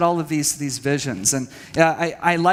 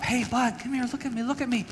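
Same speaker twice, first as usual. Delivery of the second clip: higher and softer, pleading gently for eye contact; imitated soft plea to look at him; coaxing a toddler; 7 semitones higher, 7 dB quieter than usual. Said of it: "We'd pick him up".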